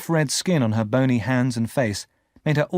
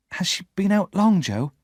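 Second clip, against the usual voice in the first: nervous; 8 semitones higher than usual. Acted. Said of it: "I asked nervously".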